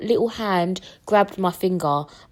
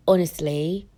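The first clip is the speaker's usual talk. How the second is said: normal talking voice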